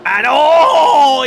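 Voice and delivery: a sudden high, loud oh; surprised shout. Real, not acted. Commentator reacting to a near crash, surprised shout. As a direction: yelp as the cars nearly touch. Near-contact during the restart.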